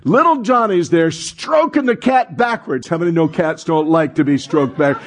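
normal talking voice

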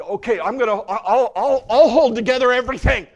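loud and high, grabbing control of everything; brash, straining declaration of self-reliance; proud shouted vow. Acted. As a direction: shout it like you'll hold it all together yourself.

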